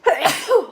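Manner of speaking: loud sudden sneeze; sneezing. Real, not acted. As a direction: sneeze sharply. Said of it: "Excuse me, I'm gonna sneeze".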